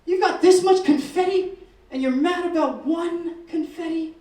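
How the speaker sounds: loud, high incredulous telling-off, slowing at the end; exasperated scolding. Retold or imitated. Teacher scolds a boy hoarding confetti, loud incredulous telling-off. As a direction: scold him in loud disbelief.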